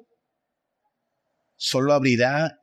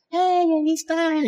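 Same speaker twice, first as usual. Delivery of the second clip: much higher, squealing like a weeping fan; mock tearful squeal; 13 semitones higher than usual. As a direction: mimic a fan shrieking at her idol. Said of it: "llorando así". (chillido burlón de fan emocionada)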